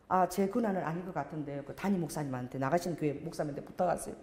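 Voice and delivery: low, soft and hesitant; muted, apologetic brush-off; reluctant refusal. Retold or imitated. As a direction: quietly beg off, passing the job along. Pastor acts out his reluctant refusal.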